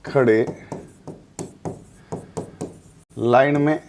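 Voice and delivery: word by word, long pauses between; slow dictation. Real, not acted. Teacher writing out the memory line for students, slow dictation. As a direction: spell out the phrase at writing pace.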